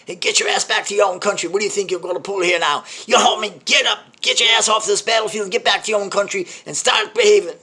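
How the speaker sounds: high, bellowed drill sergeant orders; authoritative sergeant. Acted. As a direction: shout them off the battlefield with total authority. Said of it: "They speak with authority".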